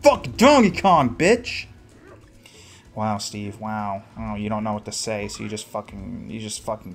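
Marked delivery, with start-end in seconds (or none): 0.0-1.7 loud, high-pitched gamer outburst; exultant cursing. Real, not acted. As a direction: crow it at the game, voice raised. Cursing the game in triumph.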